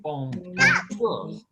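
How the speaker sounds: "Bon-, bonjour" stretched slowly, syllable split; slow broken bonjour. Shown as a demonstration. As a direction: spell "bonjour" out slowly in two parts. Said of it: "Say it with a little rhythm".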